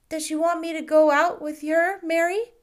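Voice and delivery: put-on a curious small boy, bright and keen; little boy asking. A narrator's reading. Boy asks the shopkeeper about marquises, high and eager.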